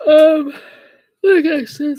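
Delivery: loud, high yawn running into words. Real, not acted. Streamer picking up after a break, yawning. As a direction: talk through a real yawn. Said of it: "sorry about the yawn".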